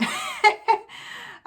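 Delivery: high, real laugh. Real, not acted. Vlogger gives up describing the accent choice, laughing. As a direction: laugh it off after a vague verdict. Laughs at own vague verdict.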